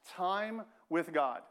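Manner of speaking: "Time" drawn out long, then "with God"; stress on TIME. Real, not acted. Preacher giving the congregation his simple answer, stress on "Time". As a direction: lean slowly on "Time", stretching it out. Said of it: "Emphasis on the word time".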